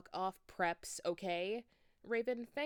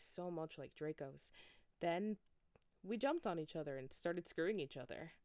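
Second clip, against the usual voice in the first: plain reading voice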